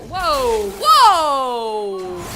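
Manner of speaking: loud, stretched alarmed whoa. Real, not acted. Dodging an attack in the game: loud alarmed whoa. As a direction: shout it as you barely dodge.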